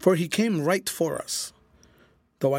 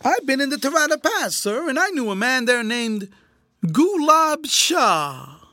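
very high, insinuating voice; menacing; 11 semitones higher, 6 dB louder than usual. A narrator's reading. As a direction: draw the name out very slowly, high and loud. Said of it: "These last were hissed out in an undertone".

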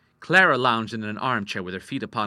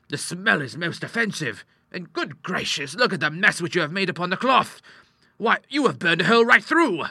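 higher-pitched exasperation building louder; indignant scolding; 8 semitones higher, 4 dB louder than usual. A narrator's reading. Doctor scolding his daughter over the burned cloth, indignant. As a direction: protest with mounting indignation.

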